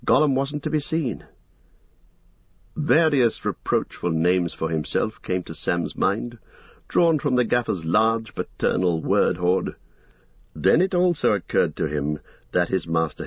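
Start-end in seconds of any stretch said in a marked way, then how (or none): none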